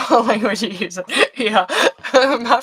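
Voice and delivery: amused, laughing between phrases; can't help giggling while talking. Real, not acted. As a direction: talk through bubbling laughter. Amused at viewer's comment on speech.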